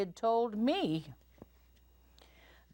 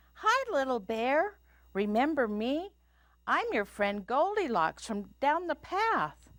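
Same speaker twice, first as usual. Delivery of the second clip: higher, singsong sweet greeting; sweetly friendly; 11 semitones higher, 3 dB louder than usual. A narrator's reading. Goldilocks greets Little Bear in bed, high and sweet. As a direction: greet him with a sugary smile. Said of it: "gave him my sweetest smile".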